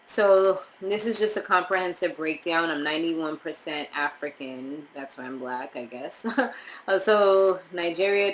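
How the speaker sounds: normal talking voice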